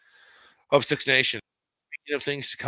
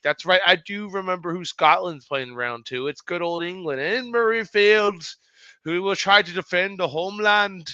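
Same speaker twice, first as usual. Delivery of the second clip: attempted Scottish accent; 6 semitones higher, 5 dB louder than usual. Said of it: "I can't get a good Scottish accent".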